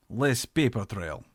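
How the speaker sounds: put-on slow, terse Russian-accented reply. A narrator's reading. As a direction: answer short in a Russian accent. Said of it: "a man in a thick accent".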